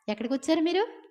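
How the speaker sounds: high-pitched prying question; nosy townsman. Retold or imitated. Townsman prying at Lot's guests, high and nosy. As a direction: ask the strangers like a busybody local.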